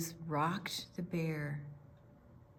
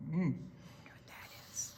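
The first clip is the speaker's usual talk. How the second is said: whisper; 4 semitones lower, 9 dB quieter, much faster than usual. Acted. Story character whispers aside.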